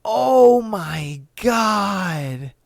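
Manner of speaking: long, drawn-out appalled reaction. Real, not acted. Host hears the kid was killed instantly, appalled. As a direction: stretch every word very slowly in dismay. Reacting to reported deadly fall.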